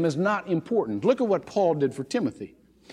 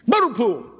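higher, louder eager phone greeting; enthusiastic young voice; 6 semitones higher, 5 dB louder than usual. Retold or imitated. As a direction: answer the phone bursting with eagerness. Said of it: "this young, enthusiastic voice".

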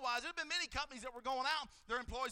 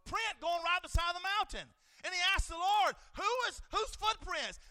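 rising, louder preacher's cry; preaching shout; 5 semitones higher, 4 dB louder than usual. Acted. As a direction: build the sermon to a loud shout.

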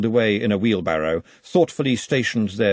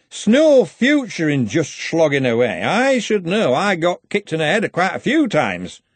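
put-on a loud drunk footballer slurring his words; sloppy, boozy slur to the patrician; slurred drunk; 7 semitones higher, 5 dB louder than usual. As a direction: lean in, tipsy and over-familiar, slurring. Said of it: "the slurred speaker's".

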